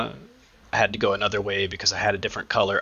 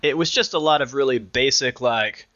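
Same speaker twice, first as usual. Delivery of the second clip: normal talking voice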